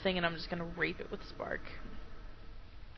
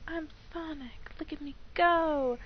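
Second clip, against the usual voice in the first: high boastful game-character voice; Sonic; 8 semitones higher than usual. Retold or imitated. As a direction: brag in a pitched-up put-on voice. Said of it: "he's like".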